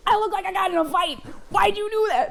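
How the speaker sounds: high mock-blubbering complaint; imitating tearful groom. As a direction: whine it like a weepy groom.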